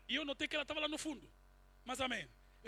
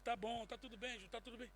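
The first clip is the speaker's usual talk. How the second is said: soothing; 2 semitones lower, 11 dB quieter than usual. Retold or imitated. Soothing her while embracing.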